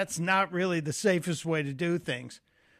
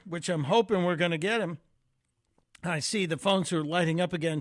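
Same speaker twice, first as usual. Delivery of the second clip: ordinary talk, nothing marked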